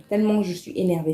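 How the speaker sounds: ordinary talk, nothing marked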